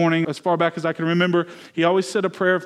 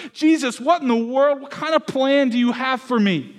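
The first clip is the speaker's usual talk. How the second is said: exasperated plea to Jesus, pitched higher; retold frustrated outburst at God, higher; a bewildered complaint thrown upward; 6 semitones higher than usual. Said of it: "I got so frustrated 'cause I was like".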